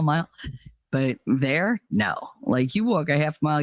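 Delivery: usual speaking voice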